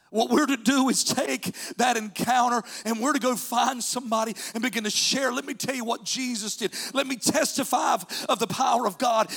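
high, loud, rhythmic exhortation; full-force preaching. Real, not acted. Preacher urging the congregation to testify, high and fervent. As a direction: drive each phrase home in a rising sermon cadence.

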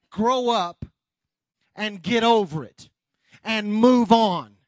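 punchy phrases split by pauses; forceful preaching. Real, not acted. Preacher telling congregation to move on, forceful preaching. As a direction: preach it in short, emphatic bursts.

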